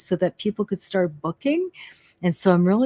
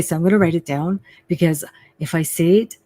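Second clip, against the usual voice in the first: usual speaking voice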